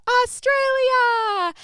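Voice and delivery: long, high, loud drawn-out crowd shout; a crowd roaring the name back; imitating crowd yell. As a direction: belt it like a whole room answering. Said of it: "everyone would yell out".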